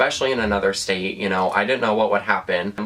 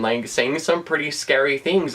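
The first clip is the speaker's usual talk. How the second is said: usual speaking voice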